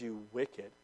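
low, soft, grave condemnation; a cold quiet verdict; stern rebuke. Retold or imitated. Master condemning the wicked servant, low and grave. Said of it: "he says".

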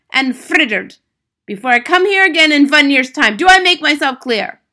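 high, loud tyrant giving orders; shrieking Grand High Witch. A narrator's reading. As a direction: bark out commands in an accented shriek. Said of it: "shrieked the Grand High Witch".

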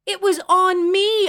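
high, slow, loud yell; furious high-pitched shout. A narrator's reading. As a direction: yell it back in outrage. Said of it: "I yelled".

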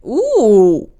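delighted with herself. Real, not acted. Host surprised by her own catchphrase, squealing with delight. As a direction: catch your own great line and light up. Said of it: "kinda good".

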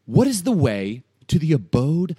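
slow, loud, grandiose mock question; God. God quizzing Job, slow booming mock challenge. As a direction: draw it out and boom it. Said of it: "this is God kinda mocking".

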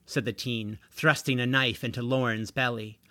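usual speaking voice

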